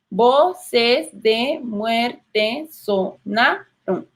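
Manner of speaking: very slow, "Voces de muerte sonaron" split into syllables. Shown as a demonstration. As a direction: tap out every syllable slowly. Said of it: "No decimos sonaron". (muy lento, separando sílabas)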